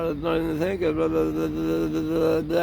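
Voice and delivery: lightly mocking careless youth; a hint of a dopey teen; mock airhead. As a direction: toss it off like a spaced-out kid. Mocking careless young drivers.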